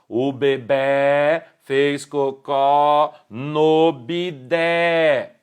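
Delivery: "bebê", "cocó", "bidé" with open final vowels, drawn out slowly; Portugal-style open endings on "bebê", "cocó", "bidé", slowly demonstrated; open stressed vowels. Shown as a demonstration. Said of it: "em Portugal seria mais comum ouvirmos".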